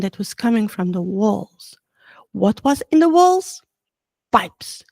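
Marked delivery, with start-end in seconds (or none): none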